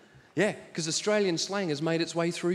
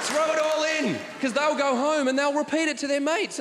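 rally the crowd, throw it all in; rallying shout; 5 semitones higher than usual. Real, not acted. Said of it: "urging the crowd".

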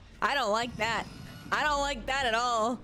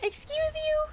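high, loud indignant excuse you; sassy, much higher outraged quip; mock offended; 4 dB louder than usual. Real, not acted. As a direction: act scandalized at the rudeness.

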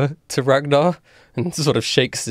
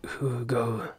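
put-on much softer, creaky old stranger; old man voice; 10 dB quieter than usual.

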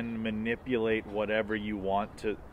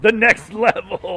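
the next level, loud, high and drawn out; excited shout; 12 semitones higher, 11 dB louder, slower than usual. Real, not acted. Friend echoing the idea of dying to the next level, loud and excited. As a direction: belt it out like a game announcer.

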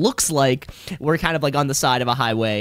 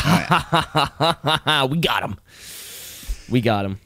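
villain cackle; 2 semitones lower than usual. Retold or imitated. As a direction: cackle like a cartoon villain rubbing his hands. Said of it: "just like, writhing his little hands".

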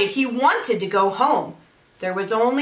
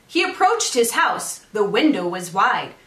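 ordinary delivery, nothing marked

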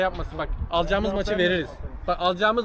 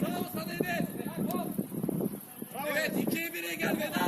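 high-pitched calls from a distance; calling out; 9 semitones higher, 8 dB quieter than usual. Real, not acted. Praising and directing teammates mid-match, high distant shout. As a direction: call across the pitch to teammates.

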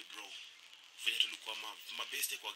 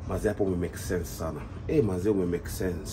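louder voice through a phone speaker; loudspeaker phone; 3 semitones lower, 8 dB louder, slower than usual. Real, not acted. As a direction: friend playing along on speakerphone. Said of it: "nimeweka speaker, loudspeaker".

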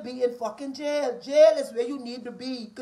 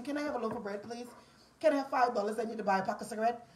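mock begging daughter; 2 semitones lower, 8 dB quieter, faster than usual. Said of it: "you just call your daddy for everything".

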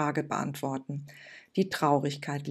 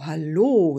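louder, falling greeting; kindly old woman calls to the girl, louder; louder deep old woman's hello; 8 dB louder than usual. Acted. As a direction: call it out warmly. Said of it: "mit einer tiefen und warmen Stimme".

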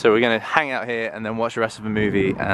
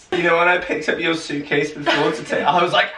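joking; 7 semitones higher than usual. Pretend. Jokey high voice.

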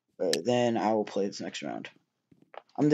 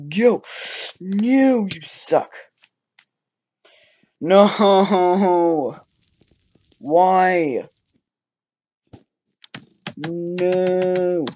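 frustrated yelling; 7 semitones higher, 8 dB louder, much slower than usual. Real, not acted. Fails the jump and dies.

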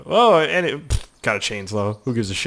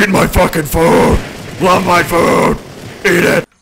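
loud, higher-pitched raging chef; Gordon Ramsay; 6 semitones higher, 8 dB louder than usual. Acted. Chef attacking out of nowhere with his food, furious bellow. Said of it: "Chef Gordon Ramsay comes out of nowhere".

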